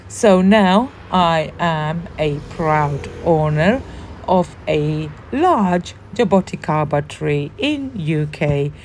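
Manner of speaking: ordinary talk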